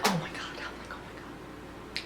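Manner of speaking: tiny, breath-only anxious murmur; stressed whisper. Retold or imitated. Viewer on edge for a player under questioning, whispering. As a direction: whisper it under your breath, as if watching in dread. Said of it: "I'm stressing".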